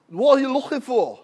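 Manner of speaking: thick mock Scouse question; old Liverpool man's accent. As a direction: do a tiny bit of broad Liverpool. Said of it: "try and do his accent".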